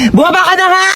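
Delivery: high-pitched fed-up outburst; acted exasperated yell; exasperated order. Exasperated kidnapper throws captive out.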